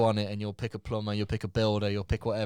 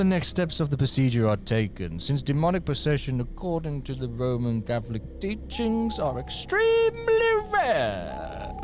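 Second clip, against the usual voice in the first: mock-sinister proclamation, soaring at the end; menacing. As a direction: build to a theatrically stretched last phrase. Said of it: "I'm having to make it look menacing".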